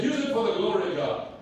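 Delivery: imitated South African accent; lightly put-on South African accent. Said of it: "brings up the South African accent".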